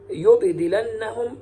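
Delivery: يبدلنهم as "yubdilannahum", silent b, light d; Shu'ba's pronunciation. Shown as a demonstration. Teacher isolates Shu'ba's word, يبدلنهم said "yubdilannahum". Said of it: "سكون الباء وتخفيف الدال".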